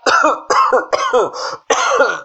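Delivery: high, put-on coughing fit; hammed-up sickbed cough; feigned cough. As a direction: cough theatrically several times. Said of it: "Avispado tosió".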